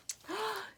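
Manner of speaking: soft high mock gasp; a playful shocked intake of breath. Teacher reacting to the silly picture, a mock gasp.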